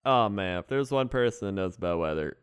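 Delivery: usual speaking voice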